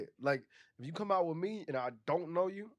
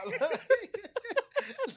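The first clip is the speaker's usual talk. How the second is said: high laugh. Real, not acted. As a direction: let out a big high laugh. Laugh after drunk confession.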